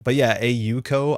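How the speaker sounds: normal talking voice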